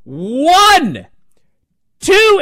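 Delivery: high, loud ref count; mock loud referee count. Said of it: "the referee was loudly counting".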